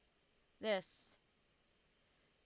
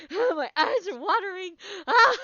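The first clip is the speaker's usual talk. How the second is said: high, loud teary laughter; laughing till eyes water; laughing hard; 12 semitones higher, 14 dB louder than usual. Real, not acted. Laughing hard after scare.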